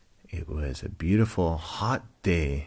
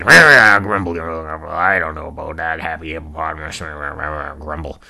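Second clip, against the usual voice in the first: put-on grumbling old rhino, loud first then trailing down; Rex; 9 dB louder, faster than usual. Grumpy rhino muttering about happy hippo, grumbling voice. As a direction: grumble through it, running the words together. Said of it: "he was a grumpy old".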